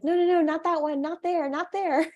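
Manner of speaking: frantic, half-laughing plea; stranded driver frantically stopping the helper, desperate. Retold or imitated. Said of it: "desperately being like".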